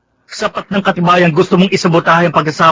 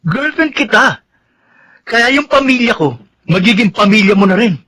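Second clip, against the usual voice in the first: scolding; 7 semitones higher than usual. Acted. Boyfriend scolds girlfriend.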